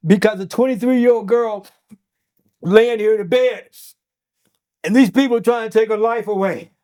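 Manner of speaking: real crying voice, high and loud; choked up, high and pleading; near tears. Real, not acted. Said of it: "without crying".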